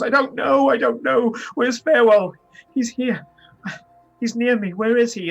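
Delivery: high, loud frantic pleading; panicked cries. Acted. Terrified seer crying out for Farewell to the caravan. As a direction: cry out in rising panic.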